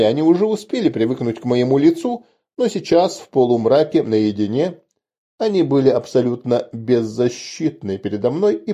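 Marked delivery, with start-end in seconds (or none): none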